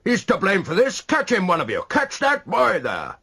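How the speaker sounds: high, loud accusing commands; angry calling. A narrator's reading. Godolphin calling on the men to catch Donna. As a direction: order the men to grab him. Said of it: "he called".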